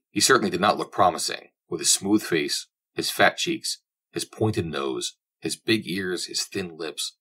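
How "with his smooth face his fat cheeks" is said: ordinary delivery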